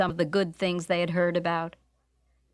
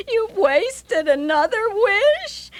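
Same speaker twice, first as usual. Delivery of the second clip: high, slow, louder tearful complaint; wailing wife; 11 semitones higher, 7 dB louder, slower than usual. Acted. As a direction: cry out the wasted wish. Sausage stuck on wife's nose.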